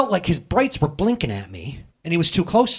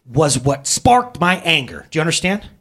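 normal talking voice

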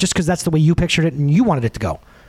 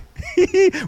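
high laugh; 14 semitones higher than usual. Real, not acted. Laugh after mock nag.

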